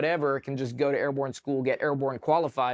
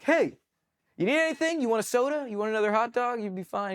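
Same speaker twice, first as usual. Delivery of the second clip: much higher, sugary fake-friendly offer; mock sweet cadre; 10 semitones higher than usual. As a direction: lay on the fake kindness to tempt quitting. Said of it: "he'd be like".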